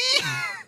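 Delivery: high manic Joker cackle. Pretend. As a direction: let out a manic villain laugh. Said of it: "I'm the Joker".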